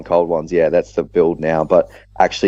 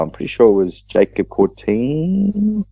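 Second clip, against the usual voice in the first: ordinary delivery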